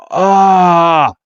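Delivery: held mock yell; mock scream. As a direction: cry out in cartoon dread. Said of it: "Red's like".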